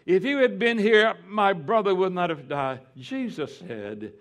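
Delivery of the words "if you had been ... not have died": high, loud grieving plea; Martha pleading. Retold or imitated. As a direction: cry out to Jesus as a grieving sister. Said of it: "When Martha said".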